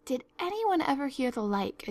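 high, mildly scandalised outburst; amazed exclamation. A narrator's reading. Marilla reacts to Anne's ghost stories, amazed. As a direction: exclaim in disbelief. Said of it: "ejaculated Marilla".

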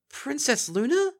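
slow, high stunned stammer; stammering in shock. A narrator's reading. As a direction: gasp out her name in disbelief. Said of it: "Twilight stammered out".

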